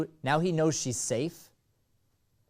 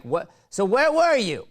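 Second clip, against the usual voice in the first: loud, much higher yelled question; from another room; 10 semitones higher, 8 dB louder than usual. Shown as a demonstration. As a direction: holler it down the hall. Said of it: "I want you yelling from the other room".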